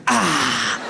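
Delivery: ordinary talk, nothing marked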